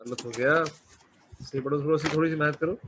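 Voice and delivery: ordinary delivery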